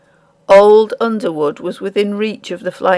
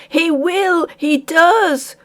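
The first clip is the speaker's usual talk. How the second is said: urgent cry; 10 semitones higher, much slower than usual. A narrator's reading. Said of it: "cried poor old Underwood".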